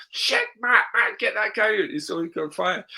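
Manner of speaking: imitating the flustered boss shouting for help; panicked version. Boss to Matt about the burning driver, mock panic. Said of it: "it'd be really easy saying".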